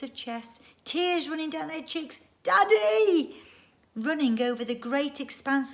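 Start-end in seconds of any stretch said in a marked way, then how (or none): 2.4-3.4 high, loud joyful call; crying out. Acted. The children calling to their dad. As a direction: shout for daddy across the plain.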